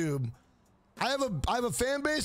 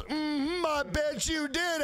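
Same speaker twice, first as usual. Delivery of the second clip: higher, slow suggestive drawl on did; knowing mock flirt; 5 semitones higher, slower than usual. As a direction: tease it like you know better. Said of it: "And she goes".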